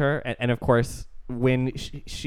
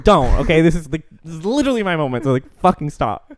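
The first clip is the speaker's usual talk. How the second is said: loud, higher-pitched irritated protest; mock indignant refusal; 4 semitones higher, 6 dB louder than usual. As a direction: imitate someone jealously guarding their moment. Acting her refusing help, annoyed.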